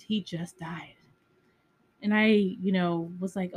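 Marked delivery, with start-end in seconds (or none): 0.1-0.9 a retold whisper, soft and unvoiced; hushed whisper. Quoting the wife's whispered news of his death, whispering. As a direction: drop to a whisper for the bad news.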